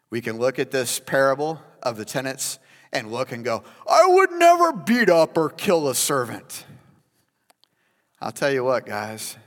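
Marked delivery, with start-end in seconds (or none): 3.9-6.6 high, loud mock indignation; indignant protest. Self-righteous listener, mock indignant and loud. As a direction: protest your innocence, hammed up.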